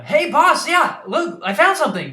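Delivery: put-on thrilled kid calling her boss over; Nimona shouting. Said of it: "and then Nimona".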